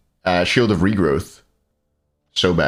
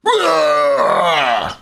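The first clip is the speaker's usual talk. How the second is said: mock lifting scream. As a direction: scream as if heaving a barbell.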